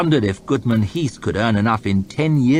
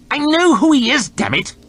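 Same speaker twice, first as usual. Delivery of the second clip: high, loud snapped retort; irritable snap; 6 dB louder than usual. Acted. Lord chamberlain snapping at his nervous secretary. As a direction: bite his head off. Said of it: "snapped Hoff".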